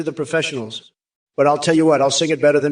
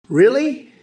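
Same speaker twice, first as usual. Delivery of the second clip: scornful rising really; mock disbelief; 9 semitones higher than usual. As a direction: pitch shoots up on a scornful question. Mocking disbelief at chat.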